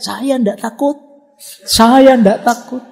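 high, loud frightened yell of denial; scared yelling. Retold or imitated. Scared friend at the toilet at night, yelling high. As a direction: yell it out, terrified but pretending not to be. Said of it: "dia teriak-teriak".

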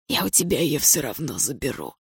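hushed, rasping threat; hoarse whisper. A narrator's reading. Pinned Andrei vowing to take the girl, hoarse whisper. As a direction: rasp it out under the breath. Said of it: "прошептал Андрей хрипло".

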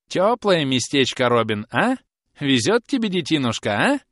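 high, loud prodding questions; needling old woman. Acted. Old woman prods groom about his cosy job, needling.

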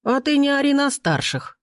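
put-on high, scolding old man; indignant bark. A narrator's reading. Old man to rude Timofey, indignant bark. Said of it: "не хуже него рявкнул дедок".

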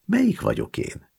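put-on high old woman's question, falling at the end; an old woman pitched high, eager to know; grandmother asking. A narrator's reading. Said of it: "kérdezte ismét az öregasszony".

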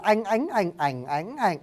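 "anh, ánh, ảnh" recited through the tones; tone drill. Shown as a demonstration. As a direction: run "anh" through each Vietnamese tone in turn. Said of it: "Bên sinh âm nó có đủ sáu dấu".

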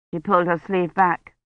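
plain reading voice

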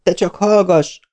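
high shriek of a furious woman. Acted. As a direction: screech at him to shut up. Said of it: "sikoltott az asszony".

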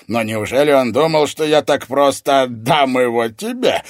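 booming sorcerer's disbelief; astonished villain. A narrator's reading. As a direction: marvel scornfully at the very idea. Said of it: "удивился незнакомец".

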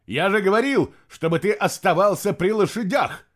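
loud, high-pitched rebuke; angry scolding. A narrator's reading. Master rounds on his stableman, scolding loudly. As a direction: lay into someone who disobeyed. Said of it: "накинулся на него Фенвольф".

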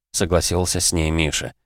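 ordinary delivery

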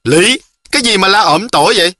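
loud, high scolding; grumbling complaint. A narrator's reading. Phương sits up and scolds the noisy girl, complaining loudly. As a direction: protest sharply at all the racket. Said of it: "ngồi bật dậy cự nự".

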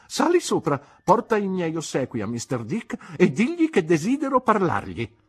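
high, loud commanding voice; brisk order. Acted. Aunt sending the maid upstairs, high loud command. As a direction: bark orders like a stern old lady.

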